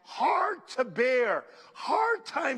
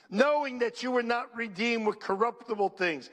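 ordinary delivery

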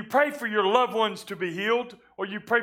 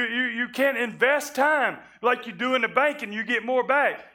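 ordinary delivery

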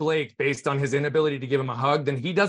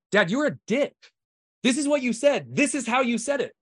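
much higher-pitched, insistent retold rebuke; emphatic confrontation; 9 semitones higher than usual. Son confronting his dad over grandson's treatment, emphatic rebuke. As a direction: drive the point home, voice pitched up.

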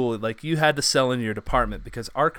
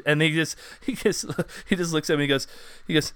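normal talking voice

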